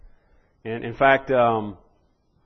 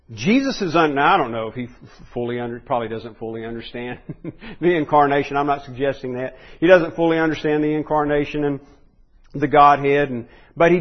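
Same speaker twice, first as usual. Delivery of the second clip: normal talking voice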